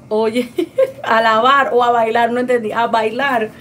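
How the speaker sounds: loud laughing read-out of a viewer's question; laughing loudly. Real, not acted. Host laughing at a viewer's dance request, loud and amused. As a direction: laughs out loud while reading the comment.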